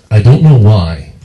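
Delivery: normal talking voice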